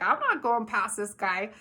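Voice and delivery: a lightly voiced stubborn donkey. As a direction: refuse like a balky donkey, half-playful. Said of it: "and the donkey was like".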